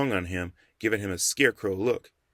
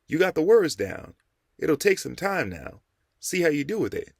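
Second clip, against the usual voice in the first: higher, louder pleased mentor; approving old mentor; 8 semitones higher, 4 dB louder than usual. A narrator's reading. The Rev praises Jamal's lesson, pleased and higher. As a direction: praise him, then ease off.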